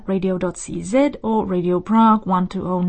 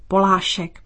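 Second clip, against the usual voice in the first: careful Czech demonstration of Polášek, PO-laa-shek; first-syllable stress, long middle. Shown as a demonstration. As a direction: stress the start of Polášek, then hold the middle vowel twice as long. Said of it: "stress is on po and the middle R is long".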